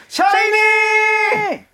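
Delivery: long loud shout. Shown as a demonstration. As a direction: call the name out long and loud. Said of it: "큰 목소리 한번 불러볼게요".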